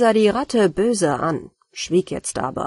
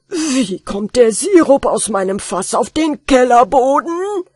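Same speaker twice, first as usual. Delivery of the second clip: loud, higher, fearsome bellow; furious roar; 4 semitones higher, 7 dB louder than usual. Acted. Master roars at the rat over spilled syrup. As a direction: roar at the rat with terrible fury. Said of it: "brüllte der Hausherr mit fürchterlicher Stimme".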